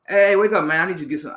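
loud wake-up call, then dropping; mock shout to the guard, fading; quoting Mirror Man. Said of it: "He's like".

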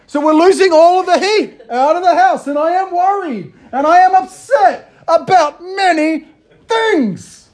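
a dad at the end of his rope; hammed-up melodramatic wail; frantic exasperation. Building exasperated rant.

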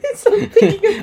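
high, loud, slow laughing words. Real, not acted. Holding the big sandal, laughing. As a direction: squeal it while laughing.

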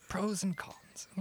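whispered thinking aloud; slow whisper to yourself. Pretend. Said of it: "let me think".

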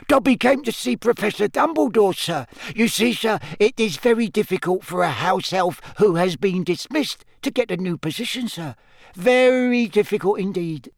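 high, squeaky, earnest elf; Dobby the house-elf. A narrator's reading. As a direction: explain it in a high servant's squeak. Dobby tells his story.

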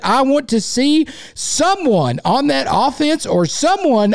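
exasperated rant. Real, not acted. Rant about the team.

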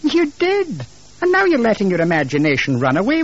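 sharp high-pitched retort; indignant wife. Acted. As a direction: throw it back at your husband, high and incredulous. Wife retorts in acted marital quarrel.

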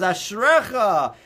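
Ashrecha said slowly and held out; drawn-out Ashrecha. Retold or imitated. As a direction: stretch Ashrecha and lean on it. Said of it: "he says".

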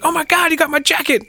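very high-pitched retold delight; mock excited relief. Courtney getting her jacket back, mock excited.

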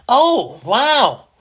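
high, drawn-out mock "wow"; mock amazement. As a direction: act exaggeratedly impressed. Mocking reaction to boring trip.

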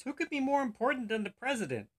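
high-pitched disbelief; incredulous question. Acted. Chief baffled by the cop's answer, incredulous.

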